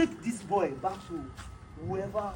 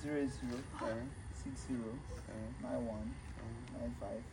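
dazed mumble; 12 semitones lower, 16 dB quieter than usual. Acted. Dazed man gives number.

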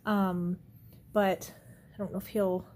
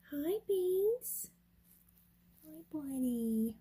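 pet voice; 6 semitones higher, much slower than usual. Real, not acted. Cooing to cat.

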